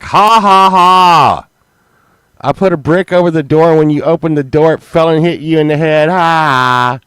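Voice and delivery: high, loud, fast mocking cackle of a prankster; gloating wife. Imitating Sunshine gloating about the brick, loud and high. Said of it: "she goes way overboard".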